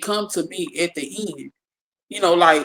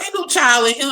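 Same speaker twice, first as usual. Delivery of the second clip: grandma voice; 7 semitones higher than usual. Retold or imitated.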